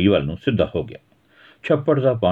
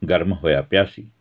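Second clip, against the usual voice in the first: ordinary delivery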